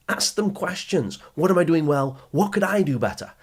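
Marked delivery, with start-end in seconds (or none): none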